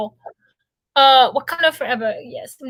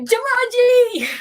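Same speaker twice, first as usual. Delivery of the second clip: mock yell; 9 semitones higher, much slower than usual. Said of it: "yelling Jumanji at the top of my lungs".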